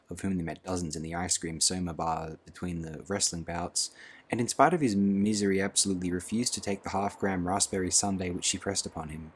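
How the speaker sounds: usual speaking voice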